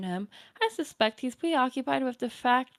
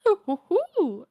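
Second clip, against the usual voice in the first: put-on high hooting old lady chuckle; teasing grandma titter; granny laugh; 8 semitones higher, 4 dB louder than usual. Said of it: "my Nana voice".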